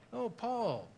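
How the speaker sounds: introducing Paul. Retold or imitated.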